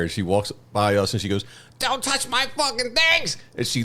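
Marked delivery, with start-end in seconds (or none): 1.8-3.4 very high mock scream, held back in volume; slow high-pitched imitation of a screamer; imitated screaming. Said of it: "she's screaming in the air".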